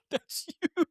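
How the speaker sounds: high, breaking into laughter; amused teasing. Acted. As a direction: tease through helpless laughter. Teasing partner about giant still life.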